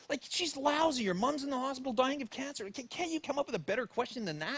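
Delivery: high-pitched, exasperated self-scolding; beating himself up. Retold or imitated. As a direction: berate yourself in a run-on inner rant. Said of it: "I'm just beating myself up".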